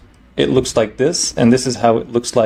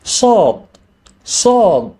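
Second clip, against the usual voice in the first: Kermit the Frog; 8 semitones higher than usual. Shown as a demonstration. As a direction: squeak "Sad" as Kermit the Frog would. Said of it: "pretend to be Kermit the Frog".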